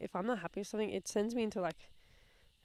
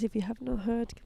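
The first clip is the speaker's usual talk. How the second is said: usual speaking voice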